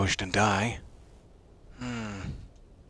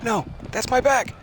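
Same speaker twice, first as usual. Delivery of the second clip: high yelp; 12 semitones higher, 3 dB louder than usual. Pretend. Player mock-yelping as character.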